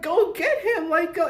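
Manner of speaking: mock yell urging the police to chase him; retold yell at police. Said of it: "I'm yelling".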